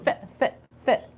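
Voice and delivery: ph- repeated as a breathy f sound; prompting first sound. Shown as a demonstration. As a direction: cue the f of photographer. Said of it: "teacher prompts first sound".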